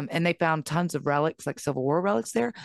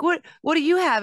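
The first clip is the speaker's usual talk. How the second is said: much higher, curious mom voice; eager question; 11 semitones higher, 3 dB louder than usual. Retold or imitated. Mom questioning her son, high and eager. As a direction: ask with playful wide-eyed interest. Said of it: "I was like".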